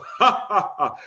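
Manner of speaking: demonstrated hearty laugh. Shown as a demonstration. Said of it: "That's my hearty laugh".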